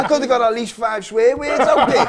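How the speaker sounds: laughing loudly through the words. Real, not acted. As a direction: let the laugh spill over the line. Laughing after asking to swear.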